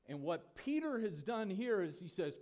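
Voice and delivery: ordinary delivery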